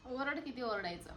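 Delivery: usual speaking voice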